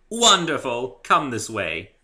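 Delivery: loud, bright and eager; Rarity thrilled to start the haircut; delighted. Acted. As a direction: gush with glee.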